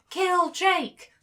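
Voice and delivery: high-pitched scoff at the idea; amused disbelief. A narrator's reading. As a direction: say it as if it's laughable. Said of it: "I laughed".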